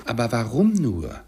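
loud, swooping cry of disbelief; exclaimed question. A narrator's reading. Nephew demands his uncle's reason, crying out. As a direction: call it out, pitch leaping on the key word. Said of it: "rief Scrooges Neffe".

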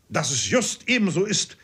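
ordinary delivery, nothing marked

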